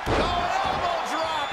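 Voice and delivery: high excited call; wrestling commentator. Acted. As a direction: call the move with a burst. Commentator calls big move.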